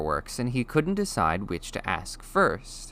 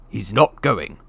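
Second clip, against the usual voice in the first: firm, louder flat refusal; angry refusal; 2 semitones higher, 7 dB louder than usual. A narrator's reading. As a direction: put your foot down. Vernon refuses.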